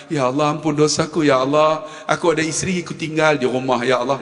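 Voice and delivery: low confessional praying voice; imitated prayer. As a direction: mimic someone confessing to God in a low pitch. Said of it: "Dia bangkal". (meniru orang berdoa mengaku dosa, suara rendah)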